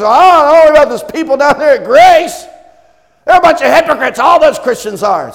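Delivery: high, loud shouting gossip; scornful critic. Pretend. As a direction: shout it like a sneering neighbor. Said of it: "when somebody says".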